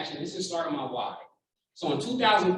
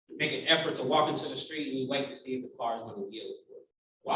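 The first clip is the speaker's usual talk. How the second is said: normal talking voice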